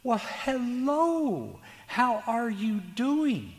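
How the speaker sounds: put-on high, slow, sing-song old lady greeting; a cheery little old lady singing out her hello; sweet old lady voice. Therapist imitates a sweet old lady greeting him, high and slow. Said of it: "and she goes".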